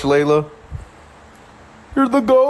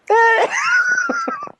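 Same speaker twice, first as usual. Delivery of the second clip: shrill burst of laughter; high shrieking laugh. Real, not acted. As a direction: squeal with delight. Reacting to viewers doing it.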